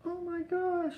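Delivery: high squeal of surprise; wife suddenly sees the kitten is real; surprised gasp. Retold or imitated. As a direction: shoot up high as it clicks. Wife realizes it's a kitten.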